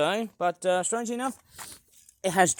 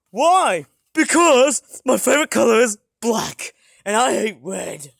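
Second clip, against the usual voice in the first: toy villain voice; 3 semitones higher, 11 dB louder than usual. Pretend. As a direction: play the shovel as a gleeful bully. Answering as Mister Shovel.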